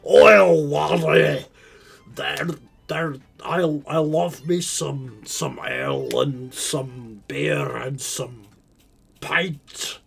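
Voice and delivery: loud, over-the-top Irish dwarf accent with stretched words; dwarven Irish accent. Shown as a demonstration. Streamer mocking caricature accents, cartoonish dwarven Irish accent. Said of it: "go do a dwarven, like, Irish accent".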